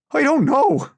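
loud, very high scream; panicked high cry; desperate denial. A narrator's reading. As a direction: scream that you truly don't know. Beaten prisoner screams denial.

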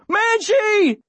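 very high cry of the name; desperate scream. A narrator's reading. Boy whose dog is grabbed, high desperate scream. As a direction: scream after your seized dog.

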